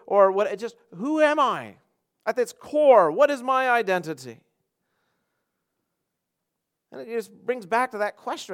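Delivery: high, forceful rhetorical questioning; emphatic preaching. Real, not acted. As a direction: hammer the repeated question at the congregation.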